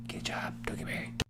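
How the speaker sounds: hushed, voiceless whisper; whispered aside. Pretend. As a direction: slip it in under your breath. Aside in the teacher's examples.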